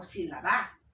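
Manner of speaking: sílaba stressed on final BA, si-la-BA; wrong stress. Shown as a demonstration. Teacher showing another wrong way, sílaba stressed on BA. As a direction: throw the weight onto BA in sílaba. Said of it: "yo no digo sílaba o sílaba".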